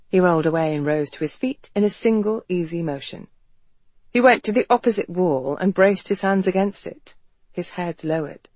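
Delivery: plain reading voice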